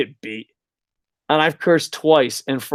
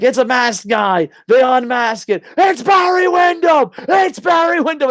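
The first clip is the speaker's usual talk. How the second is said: much higher, louder shouting call; hammed-up announcer shout; excited announcer; 9 semitones higher, 4 dB louder than usual. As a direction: lose it like the announcer.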